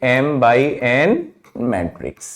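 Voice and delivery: M by N drawn out very slowly, word by word, then matrix low and soft; slow spelled-out reading. Shown as a demonstration. Teacher dictating to students, slow and deliberate. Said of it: "इसको क्या पढ़ेंगे?".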